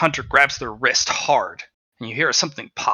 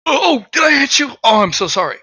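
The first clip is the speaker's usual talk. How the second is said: fake apologetic; 13 semitones higher, 9 dB louder than usual. Fake apology after punch.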